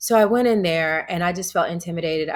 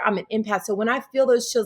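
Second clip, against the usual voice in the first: ordinary talk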